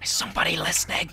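hushed, secretive whisper. Real, not acted. Player still whispering with the eerie voices, hushed whisper. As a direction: keep it down as if someone hears. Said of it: "Why are we whispering?".

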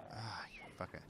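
hushed under-breath curse; whispered curse. Real, not acted. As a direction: swear it in a whisper.

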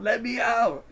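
high, rising cry to be let out; acted yell. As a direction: push the pitch up into a desperate plea. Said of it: "she yells".

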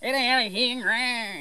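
high, raspy crow cawing out complaints; annoyed crow voice. Retold or imitated. Crow mobbed by miner birds, high irritated cawing. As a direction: caw it out like a fed-up crow. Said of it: "you know, like that".

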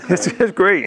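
laughing. Real, not acted. Laughing about ice cream.

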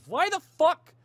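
loud, high-pitched outburst of disbelief; exasperated outburst. Real, not acted. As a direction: shout it at the game, appalled. Loud outburst at game's dialogue.

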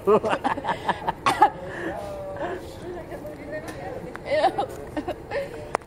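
long high giggling fit; high laughter. Real, not acted. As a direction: burst out laughing. Laughing at the puke.

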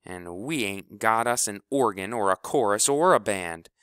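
riled up. Acted. Kleebo turns the team against their captain, loud and riled. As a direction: stir the team against him.

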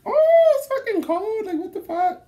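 high, loud shocked yelp at the cold; mock yelp. Retelling the cold operating room, high mock yelp. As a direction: shriek it like the room is freezing. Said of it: "I'm like".

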